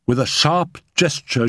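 usual speaking voice

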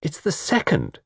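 high-pitched insistence; mildly stubborn correction; insistent. A narrator's reading. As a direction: stick to the point firmly. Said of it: "persisted Joan".

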